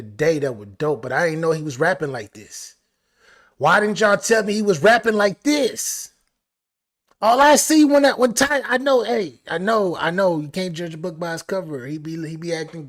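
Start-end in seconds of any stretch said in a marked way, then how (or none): 3.6-6.1 louder hyped-up question; excited. Real, not acted. Hyped reaction to the verse. 7.2-9.4 higher, louder hyped outcry; excited outburst. Real, not acted. Hyped reaction to the verse.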